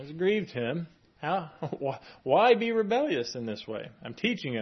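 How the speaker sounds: ordinary talk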